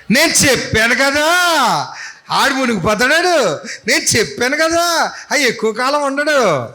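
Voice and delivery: high, sneering jeer; mocking told-you-so. Disciples gloating behind the sinking man, high sneer. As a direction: gloat like a smug bystander. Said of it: "వెనకాతల పదకొండు మంది ఏమంటున్నారండి?".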